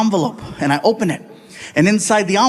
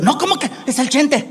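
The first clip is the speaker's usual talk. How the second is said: higher-pitched Mexican mom protest; indignant mother; 5 semitones higher than usual. Acted. Mother refusing to sit during the concert, high and indignant. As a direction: wave off your kid in a high mom voice.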